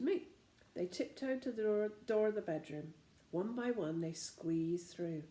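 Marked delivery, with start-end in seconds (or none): none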